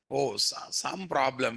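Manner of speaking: ordinary talk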